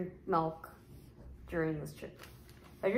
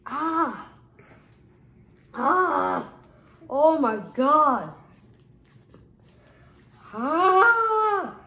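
real pained yelps from spice; pained cries; 6 semitones higher, 8 dB louder, much slower than usual. Real, not acted. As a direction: cry out in burning pain. One chip challenge, burning mouth.

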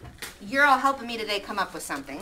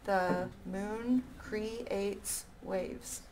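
very slow, softer read-back; very slow syllable count; 9 dB quieter, much slower than usual. Shown as a demonstration. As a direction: stretch each syllable slowly. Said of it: "That would work".

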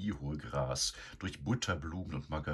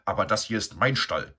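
louder insistent protest; firm protest; 5 semitones higher, 8 dB louder than usual. A narrator's reading. Eduard insisting to Begonia, louder firm protest. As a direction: put his foot down. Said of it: "stellte Eduard fest".

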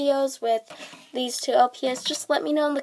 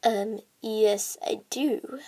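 ordinary talk, nothing marked

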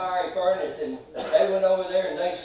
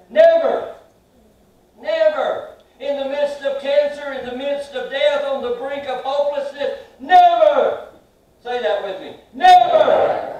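loud, much higher cries of never; preaching at full force; 8 semitones higher, 10 dB louder than usual. Acted. Preacher to the congregation, crying out never at full force. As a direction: shout never back at every trouble.

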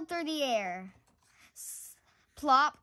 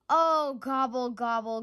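grumbled; 3 dB louder than usual. Acted. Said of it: "grumbled Turkey".

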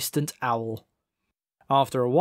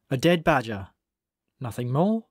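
usual speaking voice

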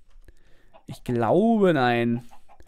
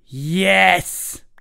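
triumphant; 5 dB louder than usual. Real, not acted.

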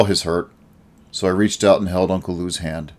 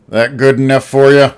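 put-on gruff, louder and higher old-man challenge; pointed; 6 semitones higher, 7 dB louder than usual. A narrator's reading. Old Al challenging Uncle Lou, pointed and gruff. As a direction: throw the question back hard. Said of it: "Al asked pointedly".

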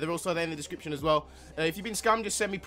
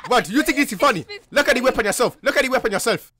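angry; 5 semitones higher, 10 dB louder than usual. Acted. Angry at scammer's laughter.

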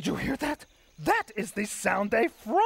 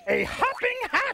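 amazed discovery; 12 semitones higher than usual. Acted. Grover marvels at rare find.

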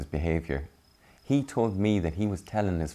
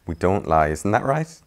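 plain reading voice

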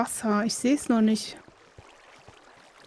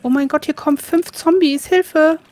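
panicked cry for help; 5 semitones higher, 6 dB louder than usual. Real, not acted. Zombies attacking, calling for help.

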